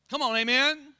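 ordinary talk

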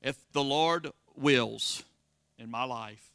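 ordinary delivery

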